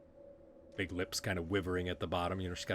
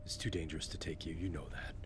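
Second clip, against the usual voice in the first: low-key, softened voice; gentle, serious Garan; 3 semitones lower, 8 dB quieter than usual. Acted. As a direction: break it to a friend quietly. Voices Garan gently refusing Corky.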